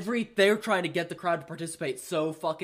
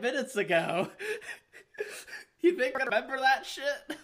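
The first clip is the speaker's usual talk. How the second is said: higher, laughing while mocking; scornful retort through laughter; 8 semitones higher than usual. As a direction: laugh at him while you put him down. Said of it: "And it's like".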